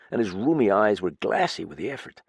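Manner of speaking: ordinary delivery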